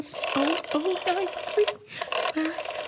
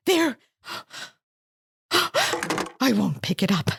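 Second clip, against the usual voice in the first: gasping fear; slower than usual. Acted. Fears intruder on extension.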